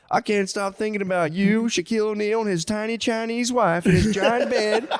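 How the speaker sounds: high, fretful southern dad; mock-distraught father. Father tormented by daughter's scandal, mock-distraught. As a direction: lament it, nearly laughing. Co-host joins as upset father.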